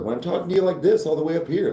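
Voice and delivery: high, fast, chirpy service voice; customer service voice. Shown as a demonstration. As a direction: pitch it way up like a cashier. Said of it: "customer service voice".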